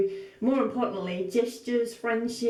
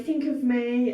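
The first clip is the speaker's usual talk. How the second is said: usual speaking voice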